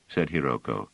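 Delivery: usual speaking voice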